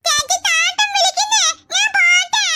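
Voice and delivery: put-on high, sweet toddler pleading to go; little child voice. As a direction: sound like a small kid asking his sister.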